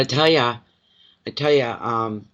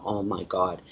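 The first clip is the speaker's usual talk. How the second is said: frozen hush; 5 semitones lower, 6 dB quieter than usual. Retold or imitated. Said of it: "That's why I freeze and I go".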